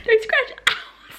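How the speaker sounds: high, giggling self-correction; laughing at own spoiler; laughing slip-up. Real, not acted. Vlogger catching herself, giggling high. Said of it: "scratch that".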